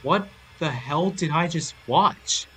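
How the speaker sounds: appalled disbelief. A narrator's reading. Viewer asks himself after a dark scene, stunned. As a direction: draw it out in bewildered shock. Narrator reacts to disturbing scene.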